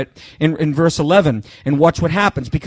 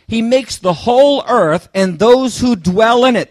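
slow, raised reading of scripture; 5 semitones higher, 4 dB louder, slower than usual. Acted. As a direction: lean into each word of the verse. Preacher reads scripture aloud.

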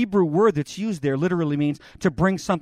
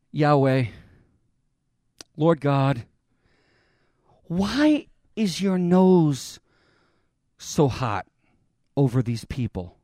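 pleading; 6 semitones lower, slower than usual. Acted. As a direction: plead gently for the people. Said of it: "He pleads for the sheep".

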